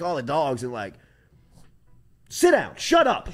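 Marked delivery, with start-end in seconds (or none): 2.3-3.3 commanding shout. Retold or imitated. Kyle Williams telling the coach off, loud shout. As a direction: bark it over the room.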